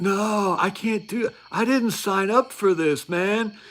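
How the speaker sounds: high, pleading whine of a scared recruit; mock a blubbering recruit who wants out; whiny panicked recruit. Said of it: "they're like".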